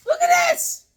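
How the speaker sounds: very high, loud cry of delight; excited shout. Real, not acted. Calling Sonia over to see the gift, a high excited shout. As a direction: shriek it out to Sonia.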